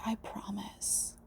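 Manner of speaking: very soft, slow whisper; hushed slow "I promise". Real, not acted. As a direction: barely breathe "I promise". Repeated promises.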